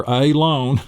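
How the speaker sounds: "alone" opened with a long A, "AY-lone"; mispronounced alone. Shown as a demonstration. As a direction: stretch the first sound of "alone" into "ay". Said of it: "it's not".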